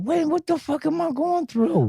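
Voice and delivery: high, bewildered self-question; confused inner voice. Retold or imitated. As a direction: ask yourself, lost and high. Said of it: "'Cause he, like, looking at himself".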